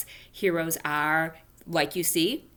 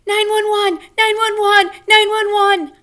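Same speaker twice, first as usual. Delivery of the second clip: frantic high yell, much louder; hollering; 12 dB louder than usual. Acted. Junie B hollering for help over her mittens, frantic yell. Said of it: "I hollered".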